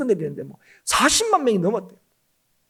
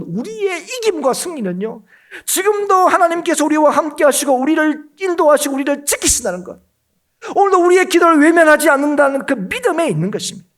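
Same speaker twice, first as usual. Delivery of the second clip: raised, loud pulpit declaration; emphatic preaching; 6 semitones higher, 5 dB louder than usual. Real, not acted. Preacher declaring to believers that God guards them, forceful proclamation. Preacher drives point home.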